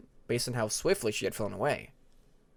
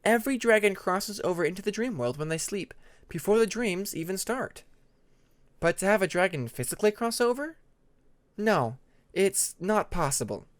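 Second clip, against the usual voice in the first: lightly voiced calm dragon teacher, a touch higher; measured lesson to a curious dragon; Tempest, patient explainer; 6 semitones higher than usual. Tempest explains dream world.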